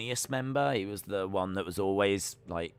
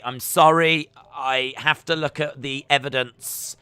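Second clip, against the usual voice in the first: ordinary talk, nothing marked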